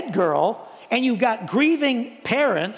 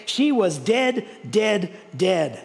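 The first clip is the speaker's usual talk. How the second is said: she was dead, each dead pressed slow and firm; insistent repetition; slower than usual. Real, not acted. Preacher insisting to congregation, firm slow stress. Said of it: "there is no doubt".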